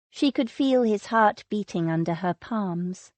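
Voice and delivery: ordinary delivery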